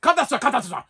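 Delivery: high-pitched mock yell in a foreign accent; excited mock foreign-accent yelling; imitated accented yelling. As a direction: mimic an agent rattling out yelled orders. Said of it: "now he's yelling at me".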